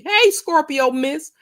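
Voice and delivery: high, slow drawn-out hey; sing-song greeting. Real, not acted. Greeting a chat viewer by name, high and drawn out. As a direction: call out to a viewer, bright and stretched.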